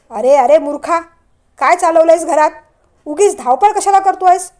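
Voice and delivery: angry shout. A narrator's reading. Father scolding his son for running into him, loud. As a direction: bark at the careless boy. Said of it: "Vishwaraj ओरडताच".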